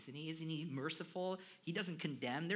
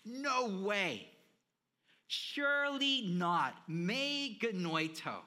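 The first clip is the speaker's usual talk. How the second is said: loud, much higher, very slow protest; outraged denial; 5 semitones higher, 9 dB louder, much slower than usual. Retold or imitated. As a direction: drag out the disbelief. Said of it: "what the listeners are saying".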